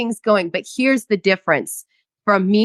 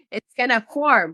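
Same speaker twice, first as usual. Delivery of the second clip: ordinary delivery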